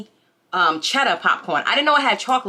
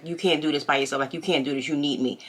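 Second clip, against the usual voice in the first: imitating a controlling voice; 7 semitones lower, 5 dB quieter than usual. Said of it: "I had somebody say".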